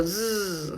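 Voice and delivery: soft buzzing zzz like a mosquito. Shown as a demonstration. Teacher buzzing to show the Z sound. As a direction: buzz like a little mosquito. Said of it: "de zancudito".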